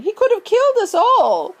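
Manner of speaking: put-on high, loud outraged old lady; shrieking old woman. A narrator's reading. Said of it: "screamed Grandma Josephine".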